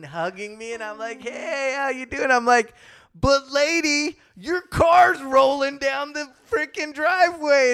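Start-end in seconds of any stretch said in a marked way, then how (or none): 1.2-2.3 high, strained polite hello; imitating an awkward squeezed hello; smothered greeting. Retold reply while being squeezed. 3.1-7.7 high, urgent retold shout; raised, strained voice of mock alarm; alarmed warning. Retold warning about rolling car.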